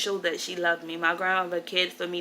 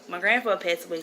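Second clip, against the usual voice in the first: ordinary delivery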